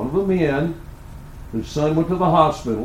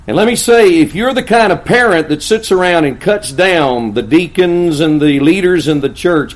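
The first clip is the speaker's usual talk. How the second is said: loud, raised warning from the pulpit; full-force preaching; 5 semitones higher, 7 dB louder than usual. Acted. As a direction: warn the congregation forcefully.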